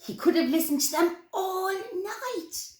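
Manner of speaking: ordinary delivery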